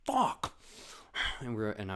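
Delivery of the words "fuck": panicked friend. Retold or imitated. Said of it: "he was just like".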